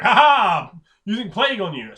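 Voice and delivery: loud, high mock-villain cackle; malicious gloating. Kobolds gloating over the plague they send, malicious gloating. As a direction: gloat over your wicked scheme.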